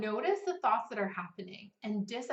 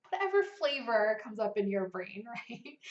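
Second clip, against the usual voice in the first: normal talking voice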